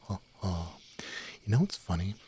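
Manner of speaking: usual speaking voice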